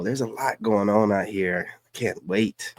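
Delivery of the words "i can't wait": normal talking voice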